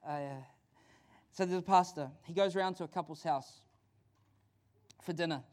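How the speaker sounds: ordinary talk, nothing marked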